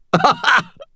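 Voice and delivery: high, pained but delighted laugh; short high giggle that hurts; helpless laugh. A narrator's reading. Knight laughs though it hurts, high helpless laugh. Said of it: "I laughed again".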